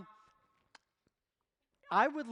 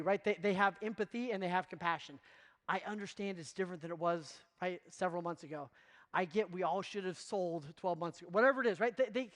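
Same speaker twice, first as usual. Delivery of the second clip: normal talking voice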